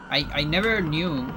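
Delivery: ordinary talk, nothing marked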